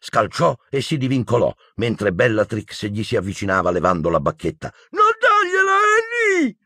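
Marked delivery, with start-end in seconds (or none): 4.9-6.6 very high, desperate wail; frantic scream. Acted. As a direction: scream a plea at the top of your range. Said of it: "urlò Neville, che sembrava fuori di sé".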